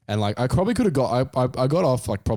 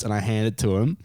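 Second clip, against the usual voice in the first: normal talking voice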